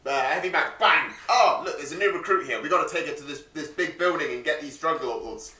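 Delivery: usual speaking voice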